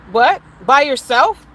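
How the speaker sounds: loud, rising disbelief; as if the idea is absurd; incredulous. Real, not acted. To viewers about a lone pregnancy, loud disbelief. Disbelief at a woman going alone.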